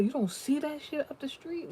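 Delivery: very high, squeaky incredulous question; incredulous driver. Retold or imitated. The driver asks her about the crash, squeaky incredulous. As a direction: ask it like she's blind to it.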